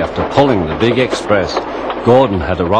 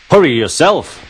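higher-pitched, pert comeback; cheeky retort; 6 semitones higher than usual. A narrator's reading. Thomas answering Gordon's order, cheeky retort. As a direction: throw the order straight back.

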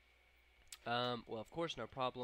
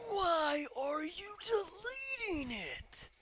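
very high, drawn-out scream; loud, slow screeching complaint; anguished wail; 7 dB louder, much slower than usual. Real, not acted. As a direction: wail it slowly in despair. Wails as game deletes message.

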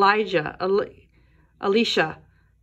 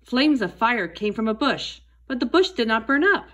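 ordinary delivery

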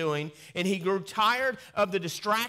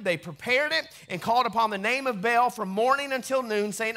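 raised, ringing Bible reading; dramatic scripture reading; 6 semitones higher, 5 dB louder than usual. Acted. Preacher reading First Kings to his church, in a raised proclaiming voice. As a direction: proclaim the passage to the congregation.

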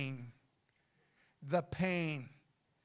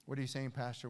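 usual speaking voice

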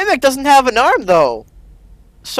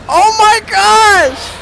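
shocked; 9 semitones higher, much slower than usual. Real, not acted. Reacting to shocking ending.

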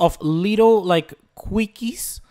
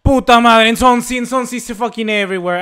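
high, loud angry curse; high furious outburst; 6 semitones higher, 11 dB louder than usual. Real, not acted. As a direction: explode at the opponent. Rival scoring threat.

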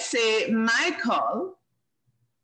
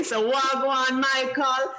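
Jamaican greeting said with extra punch; patois with oomph; 4 semitones higher than usual. Shown as a demonstration. Host greets a child in Jamaican patois, with oomph. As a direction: give wagwan some Jamaican oomph. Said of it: "put some oomph into it when we're saying it".

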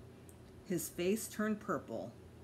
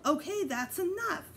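calling out; 8 semitones higher than usual. A narrator's reading.

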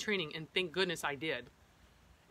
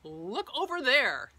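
drawn-out, higher mock yell to the kids; stretched-out retold shout; 5 semitones higher, 3 dB louder, much slower than usual. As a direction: call it out slowly as if yelling across a lookout. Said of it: "I yelled to my kids".

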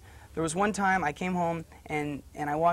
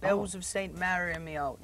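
drawn-out Cockney accent; Joey the Cockney. Shown as a demonstration. Actor quotes his play role, slow Cockney accent. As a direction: put on a slow stage Cockney. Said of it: "when I played Joey the Cockney".

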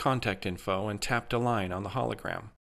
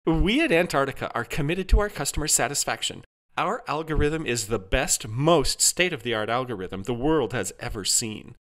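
high-pitched corporate sales pitch; upbeat, scripted customer-service assurance; resolute AI helper; 6 semitones higher than usual. A narrator's reading. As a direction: recite the company line with unshaken confidence. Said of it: "Jarvis remained calm and resolute".

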